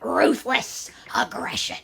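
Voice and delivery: raspy whispered catchphrase, slow; a light breathy take on the catchphrase; whispered wrestler impression. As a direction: hiss it like the wrestler's intro. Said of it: "I sounded just like him".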